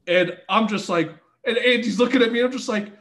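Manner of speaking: ordinary talk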